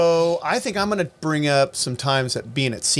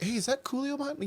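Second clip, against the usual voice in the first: high, hushed retold question; discreet asking; 8 semitones higher, 10 dB quieter than usual. Showgoer asking about [name] at the show, discreet. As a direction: ask softly, imitating a curious onlooker.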